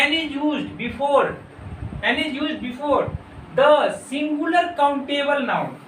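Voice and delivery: reads the rule out very slowly, word by word; slow dictation reading. Shown as a demonstration. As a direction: dictate the grammar rule slowly for students to copy. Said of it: "कह रहे हैं".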